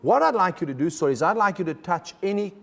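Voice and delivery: usual speaking voice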